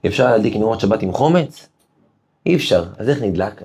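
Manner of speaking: ordinary delivery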